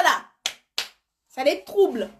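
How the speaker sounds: ordinary talk, nothing marked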